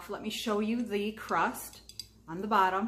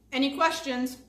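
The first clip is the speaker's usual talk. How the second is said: asked through a mouthful; raised, louder words through a mouthful; mouth full; 5 semitones higher, 7 dB louder than usual. Real, not acted. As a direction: talk around the food still chewing. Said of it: "I'm talking with my mouth full".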